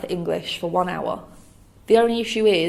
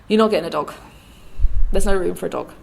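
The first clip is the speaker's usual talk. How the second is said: imitated dad, louder and insistent; firm refusal; 6 dB louder, much faster than usual. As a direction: put your foot down as the dad, louder. Dad refuses more firmly, retold.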